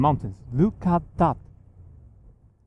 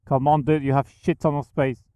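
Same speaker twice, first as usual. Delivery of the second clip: ordinary talk, nothing marked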